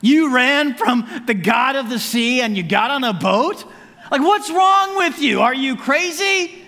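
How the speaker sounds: high, loud, drawn-out disbelief; hammed-up outrage, rising into a high shout; incredulous sailors. Sailors to Jonah who ran from God, incredulous. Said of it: "sailors go".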